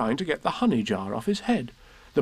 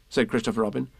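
usual speaking voice

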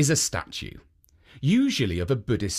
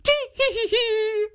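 high tittering granny giggle; high tee-hee giggle; old woman giggle. Acted. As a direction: giggle helplessly like a little old lady. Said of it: "still laughing".

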